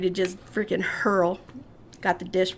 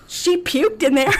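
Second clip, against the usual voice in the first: gleeful tattling; 8 semitones higher, 5 dB louder, slower than usual. Retold or imitated. Friend announcing her puking.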